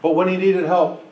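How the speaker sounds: ordinary talk, nothing marked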